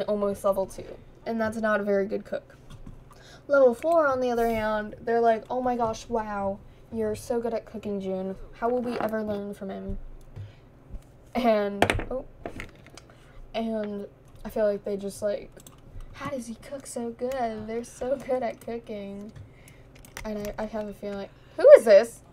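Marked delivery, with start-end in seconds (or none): none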